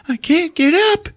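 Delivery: hammed-up tearful wail, stretched at the end; mock crying kid. Fallen little boy calling his brother, mock bawling. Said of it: "now he's really crying".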